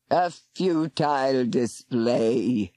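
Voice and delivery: very slow, low mockery; mocking serpent. Serpent mocking the defeated warlock, slow scorn. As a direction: scorn the little man. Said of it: "Morgauris mocked".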